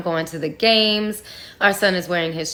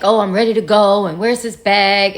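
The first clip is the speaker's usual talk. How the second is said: retold yelling; 7 dB louder than usual. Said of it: "he's yelling in the house".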